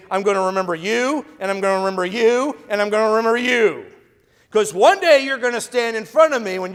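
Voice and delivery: hammed-up threat, building to a yell; preacher playing a vengeful Jesus for laughs; mock vengeful threat. Vengeful Jesus parody, building shout.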